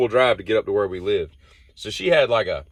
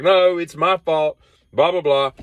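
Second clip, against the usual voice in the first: mock apology; 8 semitones higher, 4 dB louder, slower than usual. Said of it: "She's like".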